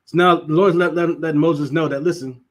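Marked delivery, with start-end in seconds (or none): none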